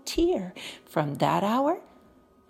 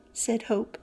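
ordinary delivery, nothing marked

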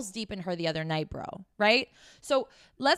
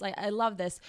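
ordinary talk, nothing marked

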